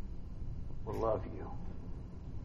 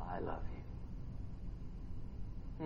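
very soft, low I love you; almost breathe it; hushed tender; 8 semitones lower, 18 dB quieter, faster than usual. Acted. God whispering love to the believer, very soft.

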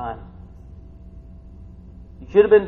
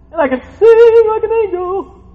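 soaring high and loud, full of wonder; excited high lift; 13 dB louder than usual. Real, not acted. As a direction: lift the line up like singing. Preacher imagines singing like angel.

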